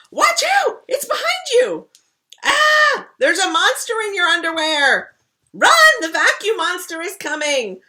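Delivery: high, loud panicked cries of alarm; frantic warning shouts. Acted. Little sister shrieking warnings at her siblings, loud and high. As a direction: yell the warning like the monster is right there.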